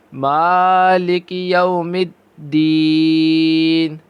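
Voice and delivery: slow, drawn-out melodic tajweed chant; Quran recitation. Shown as a demonstration. Teacher reciting Quran for students. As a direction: recite each verse long and measured.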